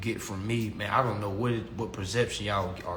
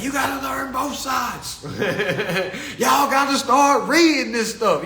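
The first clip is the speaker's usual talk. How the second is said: much higher, loud, shouting coach voice with a laugh; Patrick Devine impression; 13 semitones higher, 7 dB louder than usual. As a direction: shout it like a fired-up old tax coach. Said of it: "He's like".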